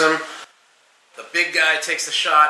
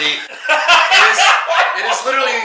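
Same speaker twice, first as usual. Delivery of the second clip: high laughing outburst; 13 semitones higher, 7 dB louder than usual. Real, not acted. Describing Guido Sloth to cohost, laughing.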